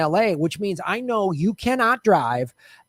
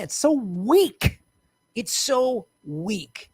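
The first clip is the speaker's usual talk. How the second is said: drawn-out disgust; much slower than usual. Real, not acted. Disgust at the mimicked whining.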